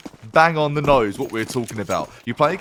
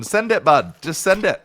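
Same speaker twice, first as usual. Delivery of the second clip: Canadian cheer; 6 semitones higher than usual. Retold or imitated. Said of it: "Typical Canadian fashion".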